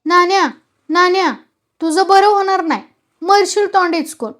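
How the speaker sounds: high, loud narrated curse; cursing. A narrator's reading. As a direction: hurl a curse at an opponent. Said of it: "केरूनं शाप दिला". (शाप देत, मोठ्याने)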